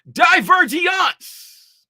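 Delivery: "Divergence" drawn out very slow and loud, high, each syllable hit; every syllable spelled out. Shown as a demonstration. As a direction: stretch "Divergence" syllable by syllable and shout it. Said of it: "spell it out with all the syllables".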